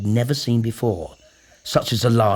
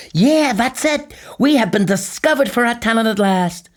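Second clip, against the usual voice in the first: put-on high, eager young boy; thrilled to be discovered; high boyish voice, excited; 12 semitones higher, 5 dB louder than usual. A narrator's reading. Tweedledum voiced.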